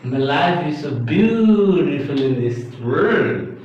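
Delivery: slow model sentence, "beautiful" stretched long; drawn-out BEAUTIFUL. Shown as a demonstration. Teacher asking students to repeat after him, drawn-out "beautiful". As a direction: linger on the first vowel of "beautiful". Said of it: "Just repeat after me".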